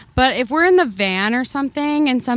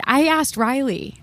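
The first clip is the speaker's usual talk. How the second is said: usual speaking voice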